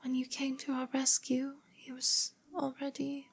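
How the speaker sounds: put-on soft, high, frail girl; faint girl's voice, barely carrying; sickly, feeble voice. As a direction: trail off feebly in a delicate voice. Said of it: "a sickly voice".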